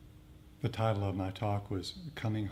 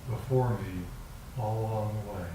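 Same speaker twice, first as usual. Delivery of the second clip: poem reading; 5 dB louder than usual. Acted. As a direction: let each image land, unhurried. Reads a poem aloud.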